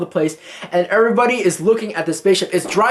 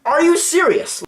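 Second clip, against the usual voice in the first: shocked disbelief; 12 semitones higher than usual. Retold or imitated. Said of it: "She was just like".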